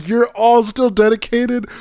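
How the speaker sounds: high, loud exasperated complaint; exasperated rant. Real, not acted. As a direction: vent in loud disbelief. Exasperated rant about crowded gym.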